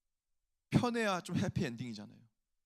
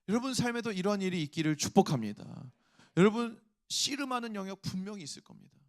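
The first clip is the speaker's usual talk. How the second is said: louder impassioned sermon voice; fervent preaching; 5 dB louder than usual. Acted. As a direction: build to a rousing exhortation. Preaching climax. (회중에게 열정적으로 외치는 설교)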